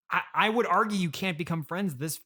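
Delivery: usual speaking voice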